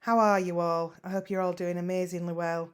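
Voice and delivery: usual speaking voice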